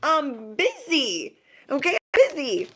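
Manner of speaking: high, emphatic stretched rebuff; exasperated brush-off. Acted. You answering the nosy neighbor, exasperated brush-off. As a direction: shoo them off with a high, drawn-out delivery.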